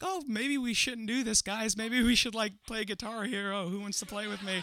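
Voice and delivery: high-pitched nervous deflection; playing it off. Boy deflecting the kissing game, high and falsely casual. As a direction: pitch it way up, pretending all is casual. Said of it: "trying to play it off".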